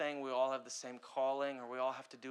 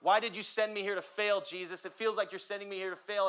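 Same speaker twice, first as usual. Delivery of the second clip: retold anguished plea; 7 semitones higher, 8 dB louder than usual. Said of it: "I remember telling God".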